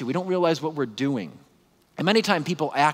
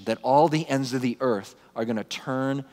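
ordinary talk

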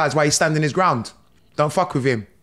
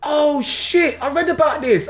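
high, dawning shock; higher-pitched mock alarm; retold realisation; 9 semitones higher, slower than usual. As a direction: replay the moment it hits you. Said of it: "and then it hit me".

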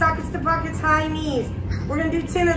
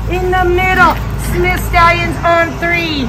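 loud rally call; 8 dB louder than usual. Real, not acted. Rallying kids for team cheer.

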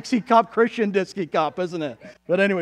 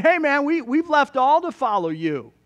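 lightly voiced Peter, higher and louder; Peter speaking up; 7 semitones higher, 4 dB louder than usual. Peter to Jesus about leaving all, speaking up. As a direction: speak up for the disciples, pitched higher. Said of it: "So Peter's saying".

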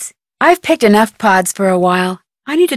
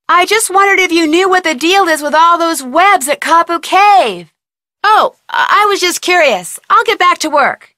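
flustered; 8 semitones higher than usual. Real, not acted. Flustered talk to Quigley.

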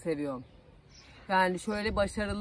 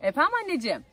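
sweet phone greeting; 7 semitones higher, 3 dB louder, much faster than usual. Real, not acted. Answering her mother's phone call.

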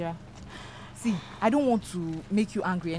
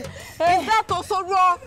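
loud, high, drawn-out question; slow, high shout over the commotion; urgent exclamation; 9 semitones higher, 8 dB louder, much slower than usual. Acted. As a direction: stretch it out at the top of the voice. Neighbour shouts amid the quarrel.

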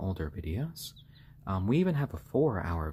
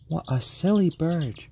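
pet talk; 8 semitones higher, 5 dB louder than usual. Real, not acted. Cooing at pet chicken.

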